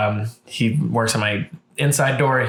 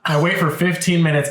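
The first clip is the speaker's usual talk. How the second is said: normal talking voice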